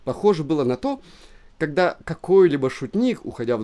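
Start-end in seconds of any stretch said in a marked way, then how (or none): none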